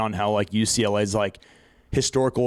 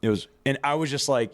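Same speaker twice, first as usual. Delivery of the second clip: ordinary delivery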